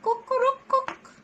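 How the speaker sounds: very high crowing call; rooster crow. Shown as a demonstration. Teacher showing the rooster, high crowing.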